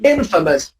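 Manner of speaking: Infamous as IN-fuh-mus; teacher's IN-fuh-mus; correct short unstressed A. Shown as a demonstration. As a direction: punch IN in Infamous, reduce the rest. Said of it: "you say infamous".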